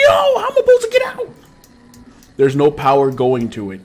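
ordinary talk, nothing marked

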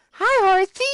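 very high, sing-out hello; cutesy greeting. Pretend. Player greets a horse, cutesy greeting. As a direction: greet an animal in a squeaky high voice.

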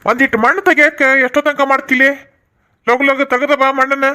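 high, hurrying voice of a nagging father; impatient father. Acted. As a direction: push the son to hurry up. Impatient father hurries son.

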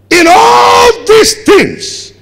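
high, loud, slow drawn-out refrain; preaching shout. Real, not acted. Preacher repeating the verse to the church, slow ringing shout. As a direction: hold each word long and ring it out.

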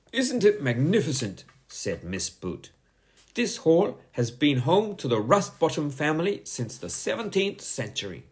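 high, firm schoolteacher voice; a strict teacher scolding a pupil; stern teacher. A narrator's reading. Teacher warning Bertie to sit down, stern and high. Miss Boot's voice.

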